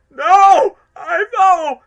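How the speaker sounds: very high, very loud crying scream; anguished scream. Retold or imitated. Fan reliving watching Ivo fall, anguished scream. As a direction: scream his name as he falls. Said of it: "first time watching it in my cosplay".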